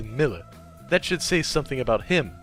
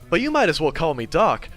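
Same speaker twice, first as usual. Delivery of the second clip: ordinary delivery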